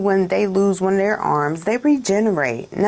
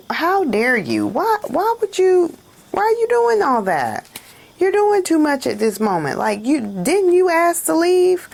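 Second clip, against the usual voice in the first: indignant outburst; 9 semitones higher than usual. Acted. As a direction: pitch shooting up, incredulous and scolding. Scolding an ex who wants back.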